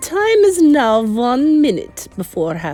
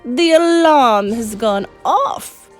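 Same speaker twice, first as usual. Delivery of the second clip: higher, louder, slow triumphant shriek; witch shrieking; 4 semitones higher, 5 dB louder, slower than usual. A narrator's reading. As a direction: shriek with delight. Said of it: "shrieked the Grand High Witch".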